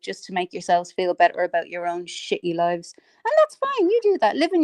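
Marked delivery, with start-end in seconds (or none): 3.0-4.6 high mocking sneer; sarcastic. Real, not acted. Mocking friends on the call, sarcastic and high.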